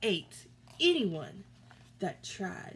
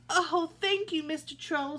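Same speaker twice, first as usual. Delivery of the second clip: high, grateful goat voice; relieved little goat; 8 semitones higher, 5 dB louder than usual. A narrator's reading. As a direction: thank him breathlessly. Relieved goat thanks troll.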